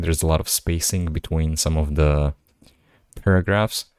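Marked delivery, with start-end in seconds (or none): none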